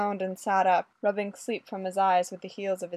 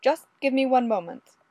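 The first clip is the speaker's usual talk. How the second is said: loud, raised stalling call; calling back; 5 semitones higher, 6 dB louder than usual. A narrator's reading. Fraser asking Olga to wait, calling back. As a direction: call it out toward the door. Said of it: "Fraser called back to her".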